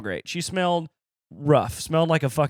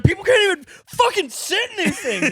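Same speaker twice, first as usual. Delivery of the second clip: whiny big woman; 6 dB louder than usual. Retold or imitated. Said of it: "she's like".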